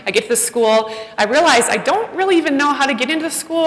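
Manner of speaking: normal talking voice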